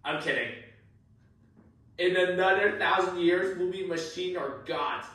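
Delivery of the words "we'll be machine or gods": ordinary delivery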